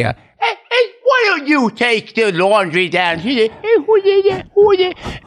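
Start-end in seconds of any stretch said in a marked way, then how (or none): 0.4-3.2 high, stretched-out nagging mom voice; Sandler's mom. Acted. Comic retelling his mom's nagging, high mock mom. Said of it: "my mom would always say". 3.6-5.2 very high mock mom screech; Sandler's mom. Comic escalating his mom bit, shrill-high mock mother. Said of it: "second verse is like, she would always say".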